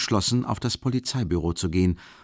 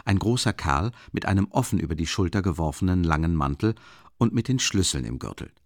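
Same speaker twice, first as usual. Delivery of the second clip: ordinary delivery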